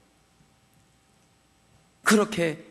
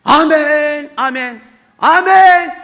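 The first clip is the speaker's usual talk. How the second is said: shouted amens, rising higher; loud amens; 5 semitones higher, 10 dB louder, much slower than usual. Retold or imitated. Believer shouting amen repeatedly in service. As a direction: call amen again and again.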